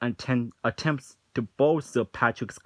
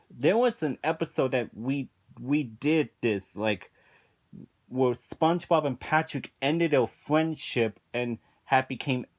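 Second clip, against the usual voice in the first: usual speaking voice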